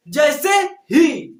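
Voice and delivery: जैसे ही drawn out slowly and called out loudly; stress on ही. Shown as a demonstration. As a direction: drag out जैसे ही and punch ही. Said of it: "जोर कौन प्रदान कर रहा है". (जैसे ही को खींचकर ज़ोर से)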